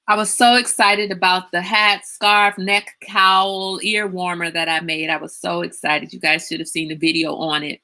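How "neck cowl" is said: ordinary talk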